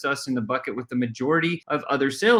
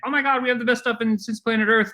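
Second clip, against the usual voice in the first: much higher, quick gushing hype; overexcited salesperson; 10 semitones higher than usual. Shown as a demonstration. Coach mocks a salesman bragging: high, fast hype. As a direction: gush like a hyped-up rep. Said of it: "instead of being like".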